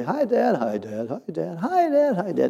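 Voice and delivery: put-on high kids' voices; chorus of little kids saying hi; lightly voiced kids greeting their dad; children's greetings. Nine kids greeting father.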